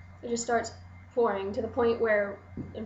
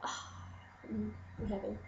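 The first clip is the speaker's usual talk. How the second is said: dreamy sigh; 12 dB quieter, much slower than usual. Retold or imitated. Swooning over kissing her idol.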